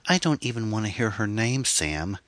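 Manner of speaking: snapped. A narrator's reading. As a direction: cut him off with sharp annoyance. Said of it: "Anne snapped".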